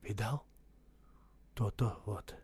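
soft whisper; quietly. A narrator's reading. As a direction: whisper it just for him. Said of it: "так же тихо молвил".

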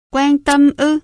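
hammed-up hurt "Quan tâm ư?"; mock wounded mother. A narrator's reading. Mother playing the suffering wife for Kevin, mock wounded.

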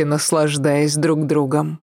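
usual speaking voice

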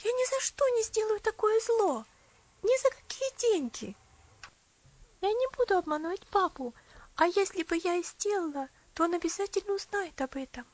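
put-on high, resolute girl; firm young girl. A narrator's reading. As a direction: refuse outright. Girl Elsie refusing firmly.

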